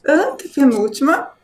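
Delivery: normal talking voice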